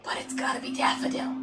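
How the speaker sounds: hushed retold thought; whisper. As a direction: breathe it like a secret. Retelling own thought, whispered.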